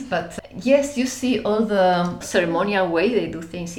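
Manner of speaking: ordinary delivery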